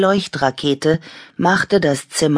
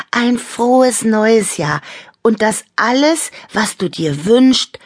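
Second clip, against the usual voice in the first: heartfelt wish; 9 semitones higher, 4 dB louder than usual. A narrator's reading. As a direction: wish it happily to a dear friend. Toy gives New Year wish, kiss.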